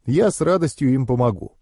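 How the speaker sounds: ordinary delivery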